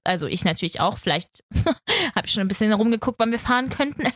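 ordinary talk

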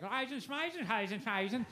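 high gibberish mock Swedish. Comic telling Swedish compere, in mock Swedish. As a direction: fake Swedish babble, sing-song. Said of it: "just speaking Swedish".

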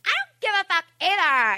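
put-on high, bratty teenager; high mock teenager shrug; mock teen voice. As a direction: toss it off like a bored teen.

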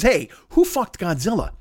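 ordinary talk, nothing marked

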